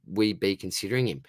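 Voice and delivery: ordinary delivery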